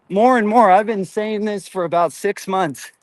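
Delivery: normal talking voice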